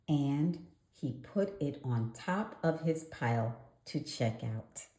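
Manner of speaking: ordinary delivery, nothing marked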